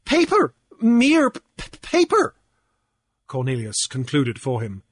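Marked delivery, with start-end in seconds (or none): 0.1-2.3 very high, loud stutter; mocking halting imitation. A narrator's reading. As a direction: mimic an opponent's stammer. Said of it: "imitating his halting utterance".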